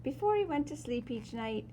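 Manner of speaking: usual speaking voice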